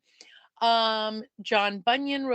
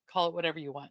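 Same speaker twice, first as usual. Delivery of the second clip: ordinary talk, nothing marked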